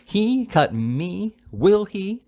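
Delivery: high, very drawn-out outrage; acted shriek, swooping up in disbelief; screaming rage. A narrator's reading. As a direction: stretch each word in scandalized fury. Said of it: "trembled with rage and disappointment".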